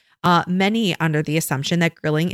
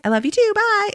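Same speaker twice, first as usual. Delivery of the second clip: put-on much higher chirpy kid goodbye; higher and perky, like a small child; little girl voice. Mom imitating her daughter's cheerful goodbye, much higher kid voice. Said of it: "And she's like".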